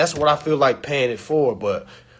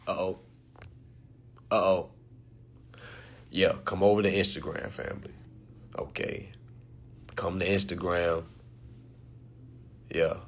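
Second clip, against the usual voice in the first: much softer, lowered voice; hushed; 8 semitones lower, 7 dB quieter than usual. Real, not acted. Inviting followers to Instagram in a hushed voice. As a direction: drop to a near whisper.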